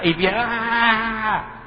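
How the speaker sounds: high mock yell from the belly; imitated yell. Preacher imitates the pastor's demo yell, hammed-up shout. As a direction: belt out a silly cry like the pastor. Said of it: "Y se ponía a gritar así".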